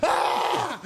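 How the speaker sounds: very high mock shriek of fright; imitated startled scream. Niece turning to find a penguin, imitated startled scream. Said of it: "And she turns".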